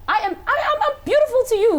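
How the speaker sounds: laughing through the words, bright and high. Real, not acted. To the host, laughing about being beautiful. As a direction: laugh it out with delight. Proudly claiming her own beauty.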